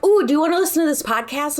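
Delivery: high, fast, eager offer; eager friend. Retold or imitated. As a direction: pitch a podcast to a friend, keen. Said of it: "she's like".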